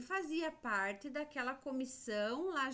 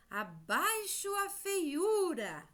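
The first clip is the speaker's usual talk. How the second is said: high, slow, drawn-out chant-like cry; spider's protest slogan; 6 semitones higher, 4 dB louder, slower than usual. Acted. Teacher voicing the spider against the caterpillar, high slow slogan. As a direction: proclaim it like a storybook protest, pitched up. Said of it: "um inseto que disse isso aqui".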